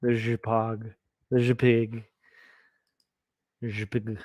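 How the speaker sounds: "J peg" savored like a French word; mock-French pronunciation. Shown as a demonstration. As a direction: say "J peg" with a zh and a short first vowel. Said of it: "how I like to pronounce".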